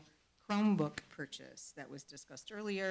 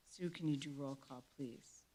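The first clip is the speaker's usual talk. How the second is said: ordinary talk, nothing marked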